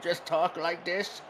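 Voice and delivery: a light creaky grandpa voice, slowed down; slow it down like a very old man. Shown as a demonstration. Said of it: "do I have to be in a grandpa voice".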